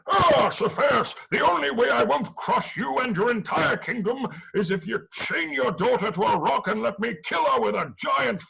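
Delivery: a god making a silly ultimatum; hammed-up angry god. Poseidon threatening King Cepheus, hammed-up high menace.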